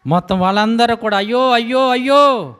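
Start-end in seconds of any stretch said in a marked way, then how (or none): 1.2-2.6 a hint of lament cry; mock wailing. Rich people mourning lost homes, mock wail. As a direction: voice their grief, wailing. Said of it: "ఎంత ఏడుపు".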